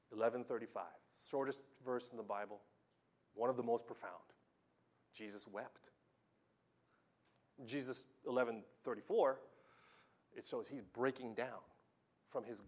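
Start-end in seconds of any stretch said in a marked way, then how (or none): none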